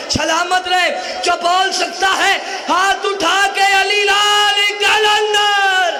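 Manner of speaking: loud, drawn-out chanting cry rising to full force; rousing slogan call. Real, not acted. Preacher urging all who can speak to raise hands, rousing slogan call. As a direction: call the crowd to answer at full voice.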